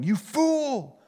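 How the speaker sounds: high, furious yell of a dying man; furious shouting. Acted. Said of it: "the near-dead man starts shouting".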